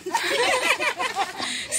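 a burst of high laughter; bright laugh. Real, not acted. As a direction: laugh at your own joke. Laughs after joke intro.

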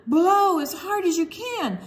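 high, loud urging call. Pretend. Reader urging children to blow the fox's balloon. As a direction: cheer the kids on to blow.

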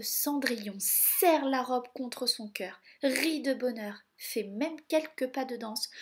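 ordinary delivery

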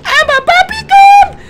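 very high squealing panic; panicked shrieking. Real, not acted. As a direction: shriek while fleeing. Chaotic panic during chase.

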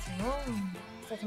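ordinary delivery

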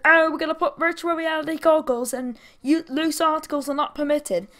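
high, loud mocking corporate voice; mocking Six Flags. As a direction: sneer it as the company. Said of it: "It's like".

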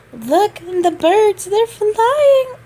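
ordinary talk

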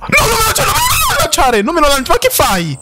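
high, frantic pleading shriek; real panic in a shrill cry; panicked shriek. Real, not acted. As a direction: beg it not to throw at you, voice cracking high. Scared of mob throwing at him.

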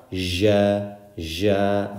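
buzzy zh in place of j in "ja"; cultural mispronunciation. Shown as a demonstration. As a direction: soften the j of "ja" into a long zh. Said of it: "how some people in their cultures pronounce it".

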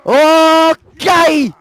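high, loud, drawn-out yell; hammed-up drawn-out shout; mock battle cry. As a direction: belt out a long exclamation. Calling out in battle.

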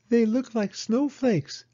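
put-on high, slow child's voice; Penny gazing at the snowdrops, high and amazed; wondering little girl. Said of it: "said Penny".

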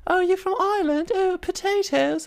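very high put-on posh voice; posh English mockers. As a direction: imitate posh people sneering in a silly high pitch. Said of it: "like, the poshest of the posh".

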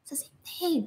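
unvoiced teasing whisper. Real, not acted. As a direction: whisper it as a sly aside. Teasing aside to a viewer.

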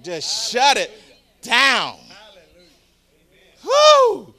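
loud, high, fervent preaching; preaching at full force. Real, not acted. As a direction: rouse the congregation.

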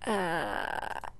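ordinary delivery

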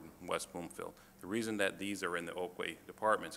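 ordinary talk, nothing marked

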